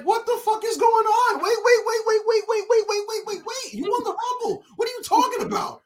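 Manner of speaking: very high, frantic protest; panicked disbelief. Retold or imitated. Fan reliving the moment the promo went wrong, panicked disbelief. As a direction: pile the words up in squealing confusion.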